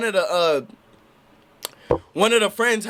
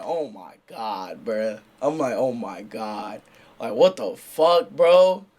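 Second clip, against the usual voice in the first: stunned disbelief; 9 semitones lower, much slower than usual. Retold or imitated. Reacting to drunk guy's bottle.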